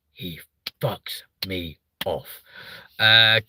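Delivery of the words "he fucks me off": slow, hushed whisper. Real, not acted. Streamer whispering his irritation with the comedian slowly. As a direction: drop to a slow, unvoiced hiss of annoyance. Venting about the comedian he dislikes.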